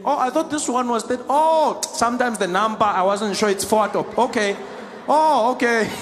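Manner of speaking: exaggerated sudden comprehension; mock realisation. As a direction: act as if it has just dawned on you. Acted sudden realisation of seat mistake.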